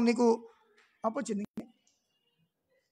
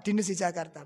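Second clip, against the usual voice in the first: usual speaking voice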